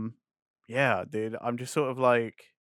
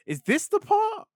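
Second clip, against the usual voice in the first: mock eager question; 4 dB louder than usual. Retelling own wondering while watching.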